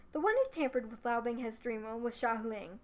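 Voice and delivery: plain reading voice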